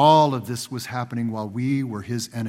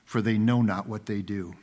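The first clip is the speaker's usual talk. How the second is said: quiet prayer; 6 semitones lower, 6 dB quieter than usual. A narrator's reading.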